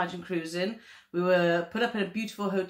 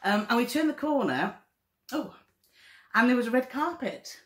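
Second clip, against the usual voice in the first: higher, moved and excited; emotional; 6 semitones higher than usual. Real, not acted. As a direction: relive the moment, overcome. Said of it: "I'm getting emotional thinking about that".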